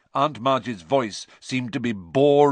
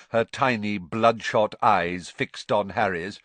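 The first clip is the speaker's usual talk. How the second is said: ordinary delivery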